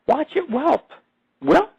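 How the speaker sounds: high yelping imitation of a kicked coyote; mock coyote yelp. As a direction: squeal the whelp way up like a hurt dog.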